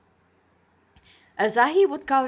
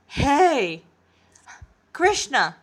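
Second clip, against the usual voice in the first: louder call to get Krishna's attention; lightly voiced young man shouting for Krishna; Nanashi calling out; 4 semitones higher, 4 dB louder, much slower than usual. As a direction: call out to Krishna, a touch impatient. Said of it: "That's Nanashi speaking now".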